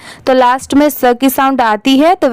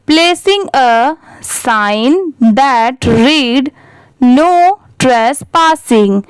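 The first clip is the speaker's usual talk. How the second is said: slow read-aloud; much slower than usual. Shown as a demonstration. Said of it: "इसे ऐसे पढ़ेंगे".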